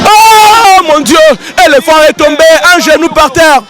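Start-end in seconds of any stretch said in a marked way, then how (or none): 0.0-1.2 long drawn-out shout of alarm; excited shout. Real, not acted. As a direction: cry it out, stretched and high. Commentator sees fighter fall. (cri prolongé et aigu, plus fort)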